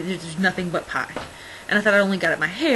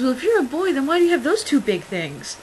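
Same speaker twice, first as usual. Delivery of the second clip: higher-pitched, curious little girl imitation; 5 semitones higher, 4 dB louder than usual. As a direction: ask it like a cheeky seven-year-old. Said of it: "and she goes".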